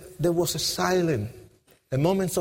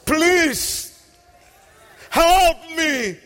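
high, loud, pleading cry; 7 semitones higher, 5 dB louder, much slower than usual. Retold or imitated. Broken gang member crying out to Jesus, desperate plea. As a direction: plead desperately from the depths, voice raised. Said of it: "I start crying out".

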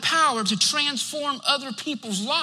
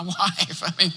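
normal talking voice

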